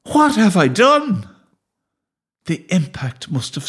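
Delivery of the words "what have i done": high, horrified wail; distraught cry. A narrator's reading. Boy realising he struck his dog, crying out horrified. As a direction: wail it high, full of guilt. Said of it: "I cried".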